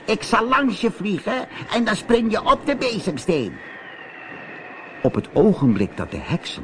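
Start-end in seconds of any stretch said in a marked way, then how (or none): 0.0-3.5 high witch voice, surprised; sea witch calling. A narrator's reading. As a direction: ask it like a curious witch. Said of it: "riep de zeeheks".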